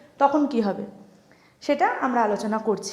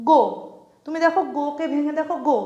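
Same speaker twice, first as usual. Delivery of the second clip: normal talking voice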